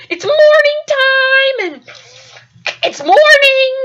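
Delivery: high, loud, stretched-out MORNING; awkwardly over-emphatic explaining; flustered excuse. Retold or imitated. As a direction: plead your excuse, dragging out "morning".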